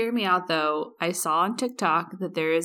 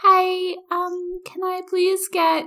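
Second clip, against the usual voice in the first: put-on high, small child's voice; little kid voice; 11 semitones higher, 3 dB louder, slower than usual. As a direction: play a tiny kid ordering. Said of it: "You're like".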